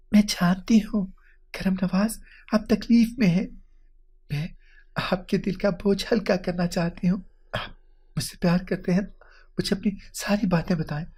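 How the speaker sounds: high, soft, halting plea; soft-voiced wife urging him to confide; tender coaxing. A narrator's reading. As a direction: coax gently, pitched well above narration. Wife gently coaxing distressed husband.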